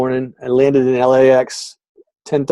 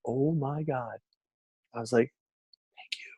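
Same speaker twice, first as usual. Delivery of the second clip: nearly silent oh my God and thanks; hushed relief; 16 dB quieter than usual. Retold or imitated. Relieved someone asks about coaching, a hushed aside. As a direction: mutter it under your breath to yourself.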